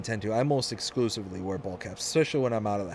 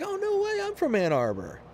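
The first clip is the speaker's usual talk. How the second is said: much higher "no way", then settling; squeaky mock surprise. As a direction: act amazed at the coincidence. Retold own surprise.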